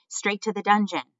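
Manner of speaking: high, loud shout from a crowd. A narrator's reading. Another mouse demanding punishment, shouting. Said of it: "another voice shouted".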